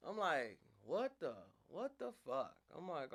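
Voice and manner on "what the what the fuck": high, slow stunned outburst; can't believe what you're hearing; stunned disbelief. Retold or imitated. Stunned at rejection.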